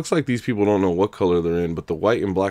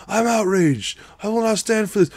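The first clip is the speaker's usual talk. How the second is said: I'm outraged thrown much higher, hammed-up; mock outrage; 13 semitones higher than usual. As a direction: overplay indignation like a stage villain.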